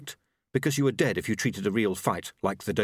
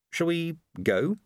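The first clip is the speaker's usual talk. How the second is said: slow, hesitant offer; tentative; 7 semitones higher, slower than usual. A narrator's reading. Lobsang nervously asking Susan to leave, tentative and slow. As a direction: test the water gently, slowly. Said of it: "he ventured".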